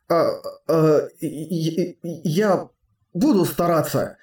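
halting, tense stutter; nervous stammer. Acted. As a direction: stammer it out under strain. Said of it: "только и смог произнести он от напряжения".

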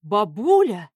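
exclaiming. A narrator's reading. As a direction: blurt it out in surprise. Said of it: "воскликнул я".

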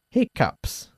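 "Hiccups" said as HIK-ups, stressed first; model pronunciation. Shown as a demonstration. Teacher models "Hiccups" for student. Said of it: "¿Cómo se pronuncia?".

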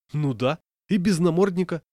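lauter, höher, empört; indignant. A narrator's reading. Indignant passenger protests.

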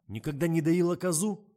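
loud, high angry question; rising anger. A narrator's reading. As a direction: let fury swell in it. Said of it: "наливался злобой режиссёр".

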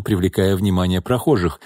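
plain reading voice

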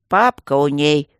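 loud, slow, weighty instruction; lecturing tone. A narrator's reading. Guardian lectures his colleague about her father, didactic. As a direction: pronounce it like a mentor. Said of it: "менторским тоном сказал Аристар".

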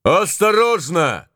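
slow high warning cry; drawn-out high shout. Acted. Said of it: "кричал Моро".

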